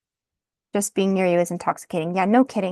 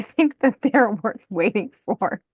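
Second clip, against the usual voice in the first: slow giggling through the words; cracking up mid-sentence; amused, laughing delivery. Real, not acted. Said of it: "I just am cracking up".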